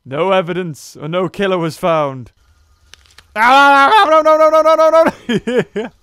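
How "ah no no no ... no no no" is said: high, loud string of no's; panicked shout. Real, not acted. Player's dinosaur under attack, loud panicked no's. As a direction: wail no as you're being eaten.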